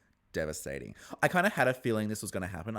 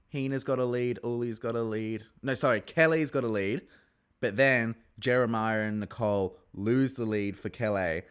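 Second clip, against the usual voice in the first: usual speaking voice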